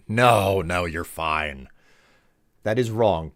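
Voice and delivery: ordinary delivery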